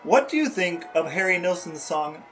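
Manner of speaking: ordinary talk, nothing marked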